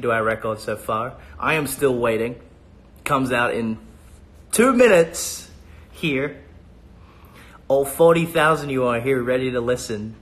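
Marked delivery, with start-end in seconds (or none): 4.5-5.5 excited. Real, not acted. Excited countdown.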